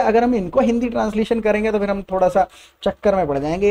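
ordinary talk

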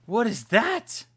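high, loud, slow alarmed question; startled cry. Real, not acted. Player spotting a strange mob, startled and loud. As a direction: stretch it out in shock at the thing.

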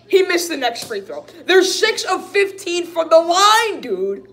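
high, loud, disbelieving complaint; exasperated outburst. Real, not acted. Fan tallying his team's misses, exasperated. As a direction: raise your voice in real frustration. Another free throw missed.

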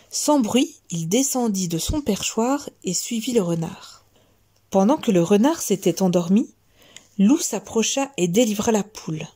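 plain reading voice

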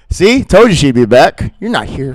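ordinary talk

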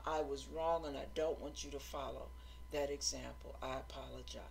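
low, soft, humble admission; apologetic. Retold or imitated. Church leader apologizing to new member, low quiet sincerity. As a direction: own up quietly and let it sink. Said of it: "she went and apologized to the guy".

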